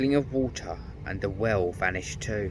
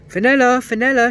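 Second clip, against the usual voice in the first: very high, very loud call; shouting a name; 13 dB louder than usual. A narrator's reading. Peter calling for the lost princess, shouting. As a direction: call out across the glade. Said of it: "shouted Peter".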